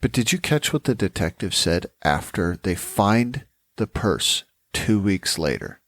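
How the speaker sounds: ordinary delivery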